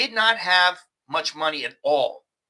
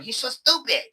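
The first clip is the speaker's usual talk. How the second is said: higher-pitched mock complaint in broken English; lightly voiced, pitched-up bargirl grumble; imitating a Thai bargirl; 6 semitones higher than usual. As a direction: whine it like a girl who wants his money. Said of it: "they're going".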